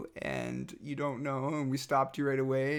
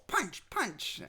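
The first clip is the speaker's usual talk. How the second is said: put-on toddler fighting; 8 semitones higher than usual.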